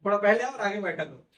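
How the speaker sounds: loud teacherly instruction to students; calling out. Real, not acted. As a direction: raise your voice to reach the class. Teacher calls out seating instruction. (ऊँची आवाज़ में छात्रों को टोकना)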